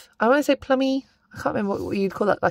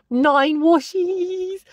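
awed drawl; 9 semitones higher, much slower than usual. Real, not acted. Counting the haul.

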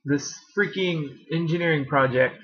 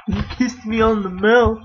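high, giggling protest at the dog; laughing squeals; 11 semitones higher, 5 dB louder than usual. Real, not acted. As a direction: squeal through laughter as he licks you. Dog licks her face.

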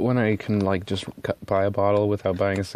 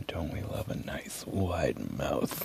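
sultry murmur; 8 semitones lower, 10 dB quieter, slower than usual. Acted. Savouring ink bottle shape.

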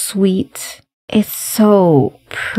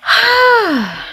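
big weary sigh. Real, not acted. Worn out after the songs.

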